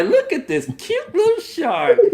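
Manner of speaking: high, slow cooing with laughter. Real, not acted. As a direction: coo like a doting aunt. Cooing at friend in costume.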